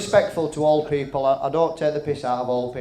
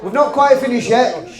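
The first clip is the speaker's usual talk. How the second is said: calling out; 6 semitones higher, 6 dB louder than usual. Real, not acted. Calling the room to order.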